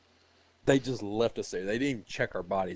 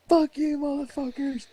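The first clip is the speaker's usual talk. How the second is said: mock battle yell; 6 dB louder than usual. Yelling while firing in story.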